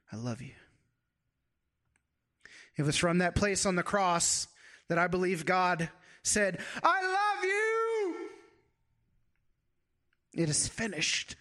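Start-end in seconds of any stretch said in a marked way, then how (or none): 0.1-0.6 soft, hushed words from the Father; whisper. A narrator's reading. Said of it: "the Father whispers". 6.8-11.4 mock yell. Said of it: "And he yelled it".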